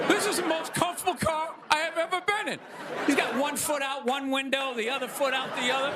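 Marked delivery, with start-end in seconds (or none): 0.1-2.6 [name] car ad. Retold or imitated. [name] Buick ad voiced.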